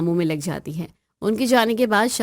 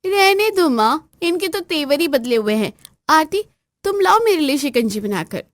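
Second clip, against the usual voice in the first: high, loud, haughty ordering voice; bossy sister-in-law; 9 semitones higher, 4 dB louder than usual. Acted. Pampered sister-in-law bossing her brother's wife, haughty high voice. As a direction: snap orders down at the daughter-in-law.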